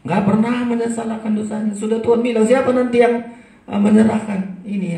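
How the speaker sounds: high, loud thundering sermon voice; loud preaching. Acted. Preacher driving home Judas' refusal to repent, loud preaching. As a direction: hammer the point home. Repeats line at full force.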